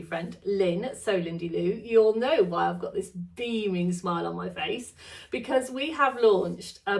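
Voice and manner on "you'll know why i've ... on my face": usual speaking voice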